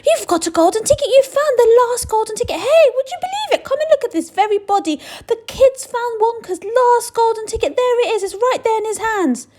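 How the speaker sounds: high, loud, thrilled shopkeeper; crowing it to the whole shop; excited shouting. Acted. Shopkeeper announces the find to the shop, loud and high. Shopkeeper exults over ticket.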